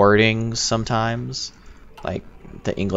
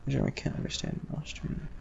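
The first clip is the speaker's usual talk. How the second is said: whispered aside; 5 semitones higher, 12 dB quieter than usual. Real, not acted. Reading a chat message aside.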